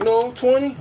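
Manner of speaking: high youthful voice; squeaky kid reply; kid's voice. Retold or imitated.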